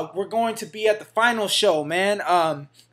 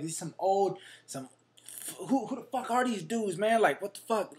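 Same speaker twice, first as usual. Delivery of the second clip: muttered to himself; 10 dB quieter than usual. Retold or imitated. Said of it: "I was like".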